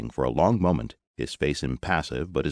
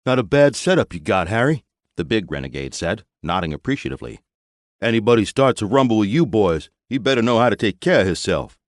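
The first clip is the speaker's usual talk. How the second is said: higher, louder swaggering biker; big biker renegade; 5 semitones higher, 6 dB louder than usual. A narrator's reading. Renegade boss sizing up the supply depot, swaggering. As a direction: size them up with a tough grin.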